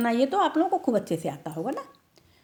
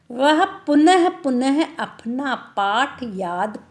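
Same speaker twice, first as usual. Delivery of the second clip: पुनः पुनः said slow and loud; stress on पुनः; 4 semitones higher, 4 dB louder, slower than usual. Shown as a demonstration. Teacher stressing पुनः पुनः while explaining to class. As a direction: lean on पुनः पुनः. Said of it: "stressing repeated word".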